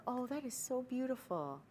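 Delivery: soft, high cooing compliment; soft high admiring coo; admiring gush. Retold or imitated. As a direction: sigh it out in admiration. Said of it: "No, this is the".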